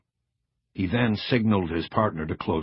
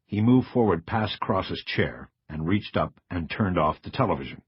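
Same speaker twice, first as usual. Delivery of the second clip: ordinary delivery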